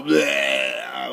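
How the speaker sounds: mock vomit noise; imitated retching. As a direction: spew out a drawn-out "bleh". Imitates vomiting onto the floor.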